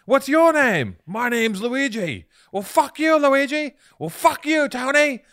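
high, loud, drawn-out names; hammed-up cartoon dog trading insults; meme dog voice. Two meme dogs introducing themselves and cursing, high loud cartoon voice. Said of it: "that meme where the two dogs".

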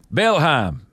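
Bellheim in a Southern drawl, long vowel; Southern accent. Pretend. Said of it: "Can you do a Southern accent?".

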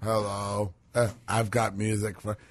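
low, subdued mocking impression; soft, low mumbling send-up; Joe Biden. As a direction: put on a low, vacant politician voice. Mocking Biden introducing music.

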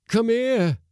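high, loud call across the deck; cook's shout. A narrator's reading. Cook calls the crew to the galley, shouting. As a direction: yell for them to come. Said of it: "shouted the cook".

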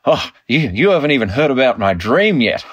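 lightly voiced dad bursting to share a secret; teasing dad. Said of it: "my dad said to me".

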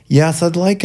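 slightly disguise your voice for the phone; put-on voice. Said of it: "I put on a voice and stuff".

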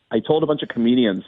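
ordinary delivery

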